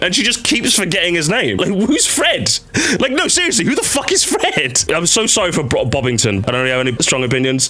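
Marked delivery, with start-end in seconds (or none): 1.6-2.6 drawn-out mock outrage; who's Fred, said slowly and puzzled; incredulous. Real, not acted. Streamer mocking the hero's forgotten name, drawn-out outrage. 3.0-4.8 mock outrage. Real, not acted. Streamer demanding who Fred is, higher exasperated outrage.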